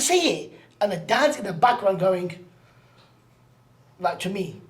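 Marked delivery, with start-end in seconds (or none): 0.0-0.6 much higher, bright push to speak; urging. Retold or imitated. Man urges shy girl to name her birthday wish, high urging call. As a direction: coax it out of a shy child.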